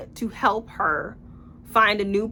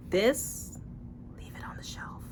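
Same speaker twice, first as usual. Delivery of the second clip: much softer whispered warning; conspiratorial whisper; 8 dB quieter than usual. Real, not acted. Verdict on the bad book.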